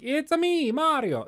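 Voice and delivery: high-pitched cartoon game hero impression; Mario. Fan showing gaming's iconic voice, high-pitched impression. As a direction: pitch it way up in the classic catchphrase voice. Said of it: "one of the most iconic voices".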